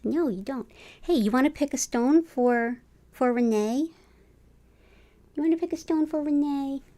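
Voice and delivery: high cooing voice to a cat; pet talk. Real, not acted. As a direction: coo it to the kitty. Talking to the cat.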